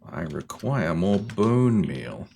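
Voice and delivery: slow sinister villain monologue; supervillain voice. Acted. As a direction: declare it like a mastermind. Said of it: "You said that like a, like a supervillain".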